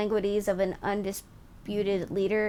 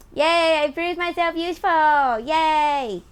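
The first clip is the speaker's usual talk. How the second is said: mock cheer; 7 semitones higher, 6 dB louder, slower than usual. Cheering being called useful.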